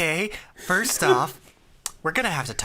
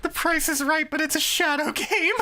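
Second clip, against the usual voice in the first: much higher, wailing announcer voice; mock announcer; 13 semitones higher than usual. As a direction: announce it like a tragic show.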